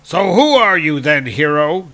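storybook dwarf challenging a stranger; demanding. A narrator's reading. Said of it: "demanded Alvis".

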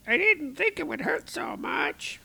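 very high, pained squeak; hurt Shadrach. Acted. Shadrach after the fall, high pained voice. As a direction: wince it out high and small. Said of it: "And Shadrach is like".

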